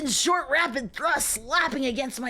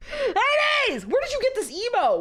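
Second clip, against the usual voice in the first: high, loud startled cry; surprised shout; 11 semitones higher, 3 dB louder than usual. Real, not acted. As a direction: yelp it out in shock. Shocked greeting to chat.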